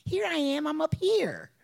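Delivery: put-on high, loud child's voice calling up to mommy; a small child calling down from the ceiling; little boy calling. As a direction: son calling to his mother from above, high and bright. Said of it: "and he said".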